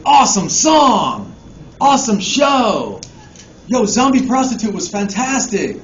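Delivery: loud, high, enthusiastic praise; gushing fans. Retold or imitated. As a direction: rave like thrilled fans after a show. Fans' excited emails voiced.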